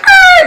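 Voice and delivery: mock screech of a fast getaway; screeching tire noise. Telling friends how the car didn't leave, mock tire screech. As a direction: squeal like tires peeling out.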